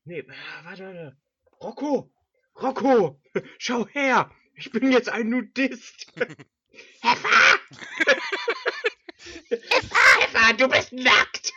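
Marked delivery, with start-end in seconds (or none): 1.6-6.1 higher, louder character line; a playful movie character voice. Retold or imitated. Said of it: "der hat anders gesprochen". 7.0-11.5 high, loud shocked cry; shocked character shout. Acted. Acting second film character.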